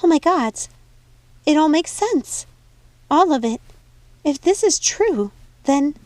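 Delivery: high, loud, excited exclamation; excited realization. Acted. Snow figuring out the secret alone, high excited exclaim. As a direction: burst out with the realization.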